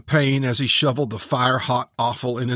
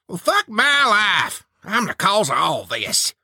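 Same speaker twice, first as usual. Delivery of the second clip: exasperated self-blame; 9 semitones higher than usual. Acted. Preacher blaming himself before comrades, high exasperated wail. Preacher laments being the cause.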